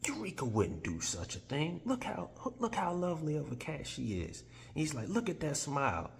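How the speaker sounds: high cooing praise for the cat; sweet-talking Tin Man. Retold or imitated. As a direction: coo over the cat to charm them.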